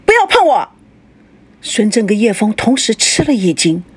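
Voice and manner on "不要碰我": high, sharp shout; a daughter shouting at her parents; yelled. A narrator's reading. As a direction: scream at them to back off. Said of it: "孙娜吼了起来".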